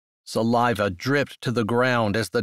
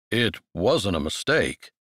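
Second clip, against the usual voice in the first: low man's voice; 4 semitones lower than usual. Acted. Man voiced, lower.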